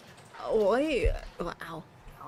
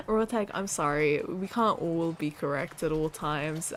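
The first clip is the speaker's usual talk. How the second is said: ordinary talk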